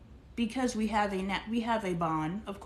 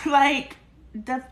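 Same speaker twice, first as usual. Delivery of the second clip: laughing; 5 semitones higher, 6 dB louder than usual. Real, not acted. Laughing after retort.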